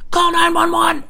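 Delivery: very high, loud emergency yell; urgent shout. Retold or imitated. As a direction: bark the alarm in panic. Stranger sees porch on fire.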